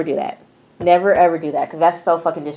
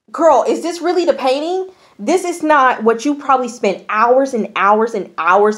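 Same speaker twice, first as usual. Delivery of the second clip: incredulous questioning; 7 semitones higher than usual. Retold or imitated. Retells own disbelief at painting.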